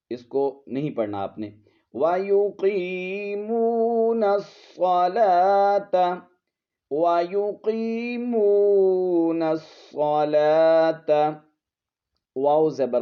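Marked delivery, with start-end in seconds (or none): 1.9-11.1 slow, high melodic tilawat; Quranic recitation. Shown as a demonstration. Teacher reciting the verse for students. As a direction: recite it slowly with tajweed.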